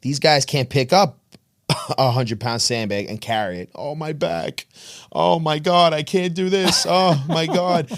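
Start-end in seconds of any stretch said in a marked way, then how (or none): 3.7-7.9 higher, whiny complaining gym guy; hammed-up weakling groaning about his back; mock whining weakling. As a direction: whine like a guy who can't lift a bag. Mocking guys who can't lift.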